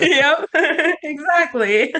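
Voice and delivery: high, loud words through real laughter; laughing agreement. Real, not acted. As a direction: agree while cracking up. Laughs while agreeing with the remark.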